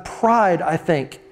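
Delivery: normal talking voice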